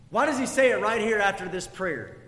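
ordinary talk

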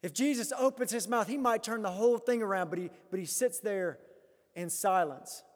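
ordinary talk, nothing marked

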